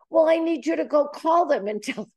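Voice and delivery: mock young person's plea; pleading grandson. Grandson asking grandmother to make the call for him, pleading.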